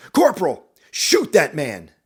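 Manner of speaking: loud, slow firm order; shouted command. Acted. Captain shouting an order at the corporal, forceful. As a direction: shout the order, holding some anger back. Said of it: "He looks at you and shouts".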